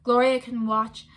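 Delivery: ordinary delivery, nothing marked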